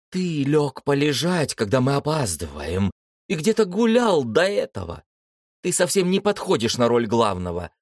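put-on a kid sister telling off her brother; lightly voiced girl nagging her brother for being late; scolding sister. A narrator's reading.

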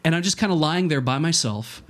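ordinary talk, nothing marked

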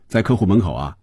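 ordinary delivery, nothing marked

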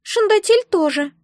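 very high little egg voice; squeaky creature. A narrator's reading. Egg says its owner doesn't know either, squeaky. Egg's voice goes on.

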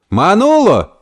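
high-pitched call of a name; shouted call. Acted. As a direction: call out sharply to get attention. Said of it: "крикнул Гомес".

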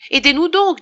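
high, loud plea for help; urgent call. Acted. Innkeeper urging the pharmacist to help, high and loud. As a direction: call him over urgently.

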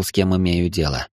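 usual speaking voice

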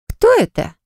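high, nosy question; pry with sudden curiosity; curious. Acted. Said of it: "полюбопытствовала Лола".

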